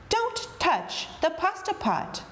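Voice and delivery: "don't touch" high, slow, each word separate; emphatic warning. A narrator's reading. Strega Nona warns Anthony before leaving, "don't touch" emphatic.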